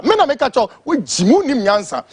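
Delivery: usual speaking voice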